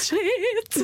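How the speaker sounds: high, laughing yelp of alarm; panicked shit. Retold or imitated. Woman realizing she'll miss the ceremony, yelping in laughing alarm. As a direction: blurt it out in a sudden panic.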